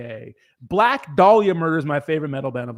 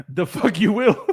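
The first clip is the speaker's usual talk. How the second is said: playful jab with a laugh; laughing retort; 4 semitones higher, slower than usual. Real, not acted. Streamer laughs back at a viewer's dare. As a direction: laugh through the mock threat.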